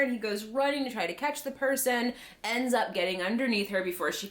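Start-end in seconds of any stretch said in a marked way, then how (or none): none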